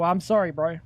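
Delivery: normal talking voice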